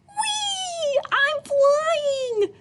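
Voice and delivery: high thrilled squeal down the slide. Acted. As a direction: shriek with glee sliding down. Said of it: "squealed Flip Flop".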